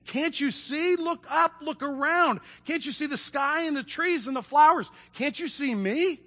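high, insistent appeal; acted exasperated girl, pitched high; urgent pleading. A narrator's reading. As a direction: beg them to open their eyes. Lucy pleads with blind dwarves.

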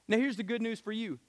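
usual speaking voice

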